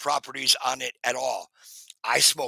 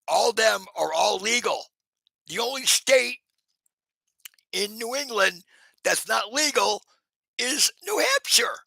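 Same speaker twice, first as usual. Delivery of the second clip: emphatic rant; 7 semitones higher than usual. Real, not acted. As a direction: stress the injustice. Emphatic complaint about his state.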